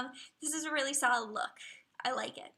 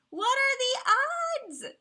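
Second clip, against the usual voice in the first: much higher, louder and slower, mock gasp; squeaky mock voice; 11 semitones higher, 7 dB louder, slower than usual. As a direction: playfully ham up the disbelief. Mock dramatic movie-quote setup.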